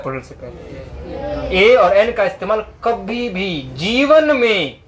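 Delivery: slow, weighty, every word pressed; slow emphatic rule. Shown as a demonstration. Teacher hammering a grammar rule into the class, slow and weighty. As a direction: drive the rule home slowly. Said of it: "याद रखना मेरी बात".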